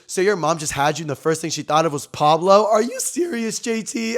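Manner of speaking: loud, rising mock disbelief; incredulous. Reacting to disbelief that Pablo is his name, incredulous. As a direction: build to a high, incredulous outburst.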